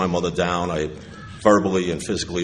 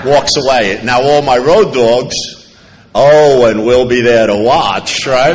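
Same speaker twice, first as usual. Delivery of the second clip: much louder and slow, building up the story; louder excited telling; 5 semitones higher, 13 dB louder, slower than usual. Real, not acted. Speaker to AA crowd, much louder and slow. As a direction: whip up the room on the big moment. Narrating the sponsor's challenge, building.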